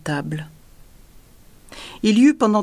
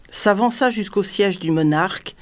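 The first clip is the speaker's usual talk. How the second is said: usual speaking voice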